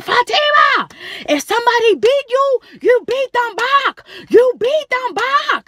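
high, loud, slowing furious order; angry yell. Retold or imitated. Mother ordering her daughter to fight back, loud and slow. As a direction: bark the order, drawing out the last words. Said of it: "she was so mad".

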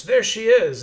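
loud, high accusing shout; pointing her out. Acted. Pursuer spots the fleeing girl in the crowd, accusing shout. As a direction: call out the quarry to the others.

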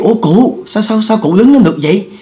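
high-pitched startled exclamation, stammering. Retold or imitated. Housekeeper finds the bedridden old lady standing, startled. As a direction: blurt it out as if shocked out of your wits. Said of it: "bà hết hồn".

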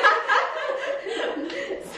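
squealing high laugh. Real, not acted. Laughing after joke.

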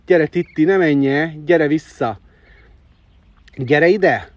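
loud coaxing call. Real, not acted. Calling Titti back to the pen, loud coaxing.

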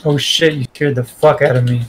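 ordinary delivery

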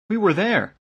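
put-on high-pitched girl's voice; light, high young voice; village girl. A narrator's reading. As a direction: chime in as an eager young witness. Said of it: "the girl from the village told them".

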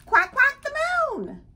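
high, loud duck exclamation; excited duck. Acted. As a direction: cry out at sighting your destination. Duck arriving at the moon.

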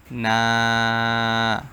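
না held for about three seconds; long drawn vowel. Shown as a demonstration. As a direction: stretch না out evenly. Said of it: "তিন second পরিমাণ টান দিবেন". (না তিন সেকেন্ড টেনে)

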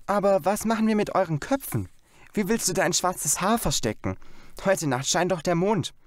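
put-on young girl Janet. A narrator's reading. Sister asks brother about hiding their heads, light girl voice. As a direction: play the excited little sister.

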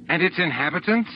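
high, accusing question; furious question. A narrator's reading. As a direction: demand the answer with contained fury. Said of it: "The fury of the question".

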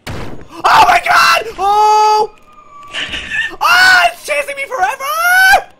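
slow, high, terrified screaming; scared horror game streamer. Real, not acted. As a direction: scream for your life while fleeing. Chased by skull monster.

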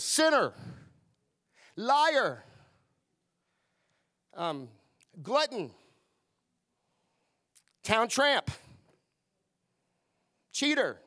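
mock-accuser spitting out names; accusing labels. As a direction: hurl each label like an accusation.

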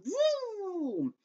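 high whooshing whistle sound; arrow whizz. A narrator's reading. Arrow flies past the fleeing children, whizzing noise. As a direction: imitate an arrow flying past.

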